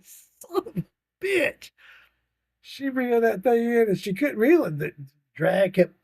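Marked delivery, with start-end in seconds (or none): 0.0-1.7 much higher, slow astonished oath; amazed. Retold or imitated. Grandpa seeing the eight-pound bass, astonished. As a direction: marvel at the size of it.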